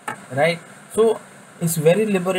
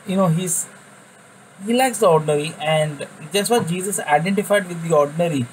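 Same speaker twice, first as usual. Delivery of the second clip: usual speaking voice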